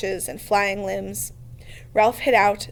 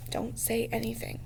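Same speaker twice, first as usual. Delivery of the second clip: much softer, barely a breath; hushed into ear; 9 dB quieter than usual. A narrator's reading. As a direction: murmur straight into a friend's ear. Ralph's mouth against Piggy's ear.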